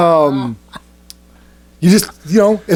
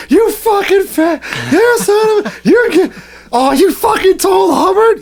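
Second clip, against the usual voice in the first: high-pitched mock fury; re-enacted exasperated squeal; retold outrage; 14 semitones higher, slower than usual. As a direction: rant in a high voice, imitating an outraged friend. Retold friend's angry outburst.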